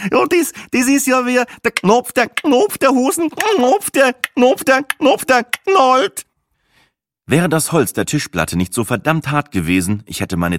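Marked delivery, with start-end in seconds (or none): 0.1-6.3 much higher, louder giddy mockery of clicks; a tipsy woman squealing about a popping button; schrille alberne Frau. A narrator's reading. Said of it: "Rosinengruberin einen Anfall von guter Laune". (hoch und laut kichernd nachgeäfft)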